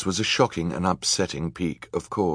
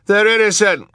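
very high, loud protest; crying out; 8 dB louder than usual. A narrator's reading. Hal defending the children, very high loud cry. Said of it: "cried Hal".

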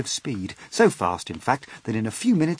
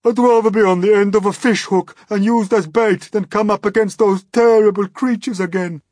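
shrill, much higher, loud shriek; a high, loud panicked shriek; 11 semitones higher, 11 dB louder than usual. Acted. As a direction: shriek in panic like a terrified worm. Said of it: "shrieked the poor blind earthworm".

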